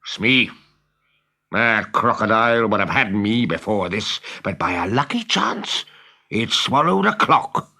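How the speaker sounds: a captain admitting his dread of the crocodile; Hook the pirate captain. Acted. Hook confides fear to Smee.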